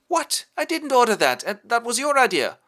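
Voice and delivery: high, loud indignant denial; indignant prince. Acted. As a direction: squeal your innocence. Prince denies ordering murder.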